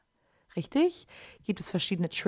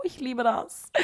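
higher-pitched, gushing aside at normal volume; 4 semitones higher, slower than usual. Pretend. Podcaster confessing her love of Q-tips, gushing. Mock confession, repeated much louder next.

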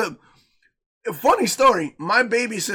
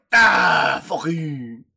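mock French accent; 3 semitones higher, 4 dB louder than usual. French fans answering the stink talk, mock French accent. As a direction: protest loudly in a put-on French accent. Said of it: "we got fans in France going".

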